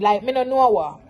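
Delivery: usual speaking voice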